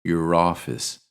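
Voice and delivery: "your office" as "yer roffice", your unstressed; linked "your office" with an r carried over; weak "your" into "office"; reduced your office. Shown as a demonstration. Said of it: "when phrased naturally sound like".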